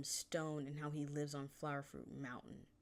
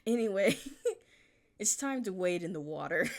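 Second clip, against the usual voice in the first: laughing through it; 4 semitones higher, 8 dB louder than usual. Real, not acted. Laughing while announcing next scene.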